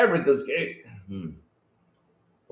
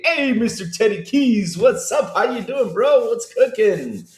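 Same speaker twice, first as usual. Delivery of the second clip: much higher and louder, hyped-up hellos; excited greetings; 11 semitones higher, 4 dB louder than usual. Real, not acted. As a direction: greet each viewer like a hype man. Host greets a chat viewer.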